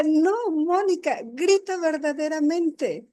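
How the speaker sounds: don't let her off the hook; insisting friend. Retold or imitated.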